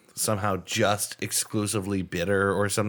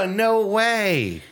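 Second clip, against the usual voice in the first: amazed disbelief; 10 semitones higher, 4 dB louder than usual. Real, not acted. Still amazed at the answer.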